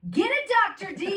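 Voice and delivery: high, loud whoop of a heckler; retold scream. Daughter calling out to the screen mid-movie, screaming. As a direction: shout it out like a kid cheering at the screen. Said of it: "she screams".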